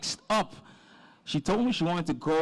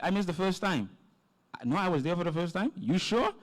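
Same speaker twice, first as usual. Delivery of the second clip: ordinary delivery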